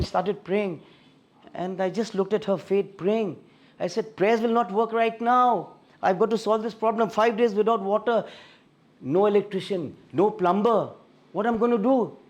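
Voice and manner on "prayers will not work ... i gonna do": frustrated rant. Retold or imitated. Frustrated husband ranting.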